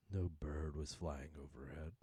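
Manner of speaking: usual speaking voice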